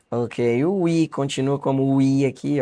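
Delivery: ordinary delivery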